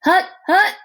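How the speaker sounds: high, loud hut call; yelled snap count. A narrator's reading. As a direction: bark the count like a quarterback. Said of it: "Hector yelled".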